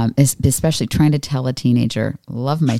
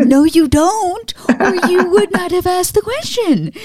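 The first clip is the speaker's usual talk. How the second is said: much higher, slow, hammed-up exasperated parent; mock exasperated mom; slower than usual. Mom retorting to her kids who say I know, mock exasperated. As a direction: play the fed-up mom to the max. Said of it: "I'm like".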